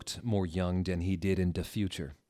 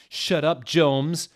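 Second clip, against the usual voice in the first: loud, higher angry shout; 8 semitones higher, 9 dB louder, slower than usual. Acted. Draco's dad shouting at James. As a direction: bark it at Jones. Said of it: "Draco's dad shouted".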